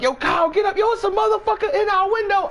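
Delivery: very high, fast alarm; Lance waking Kyle. Retold or imitated. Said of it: "So he's like".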